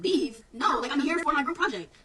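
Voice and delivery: student loudly refusing to leave. Retold or imitated. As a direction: push back at the teacher loudly. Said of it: "I'm like".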